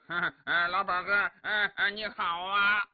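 giggly, halting fool's hello; simpleton's greeting. A narrator's reading. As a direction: laugh through a dim-witted greeting. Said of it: "但也知道是个傻子".

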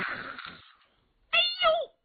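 a slow, very high yelp that cracks; voice cracking yelp. Acted. The hammer warrior yelps as the blow lands, voice cracking. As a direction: let the cry break upward as the blow lands. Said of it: "黑如虎可就喊岔了音儿了".

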